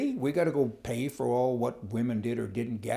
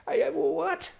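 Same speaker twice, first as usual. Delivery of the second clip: a sudden very high squeak; flabbergasted; slower than usual. Real, not acted. Reacting to the Trudeau housing money, a very high flabbergasted squeak. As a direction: splutter in disbelief.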